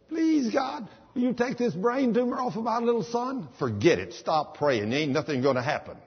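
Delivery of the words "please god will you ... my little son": high, pleading whine on "Please, God"; mock whiny prayer. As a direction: parody a faithless, begging prayer. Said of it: "You don't come in and say".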